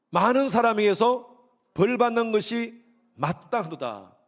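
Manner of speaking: loud, high, slow scripture line; emphatic reading. Real, not acted. Pastor rereading verse six slowly and loudly. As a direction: lean hard on every word.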